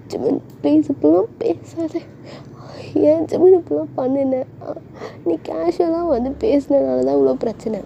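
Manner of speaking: high, whiny plea; acted tearful-sounding complaint; pleading girlfriend. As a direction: reproach him in a high, upset girl voice. Girl pleads and blames boyfriend.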